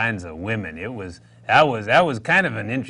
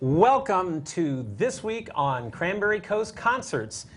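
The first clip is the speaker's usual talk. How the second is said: upbeat TV host intro; bright welcome to the viewers; show host; 9 semitones higher than usual. Acted. Host welcoming viewers to the weekly TV show, announcer read.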